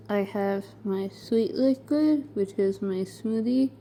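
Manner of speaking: usual speaking voice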